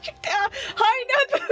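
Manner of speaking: high, startled hello; flustered greeting. Real, not acted. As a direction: greet someone who walked in at the wrong moment. Flustered greeting of arriving friend.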